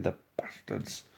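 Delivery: soft whispered aside; whispered curse. Real, not acted. Muttering about the club that signed his player, whispered. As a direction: breathe the curse out under his breath.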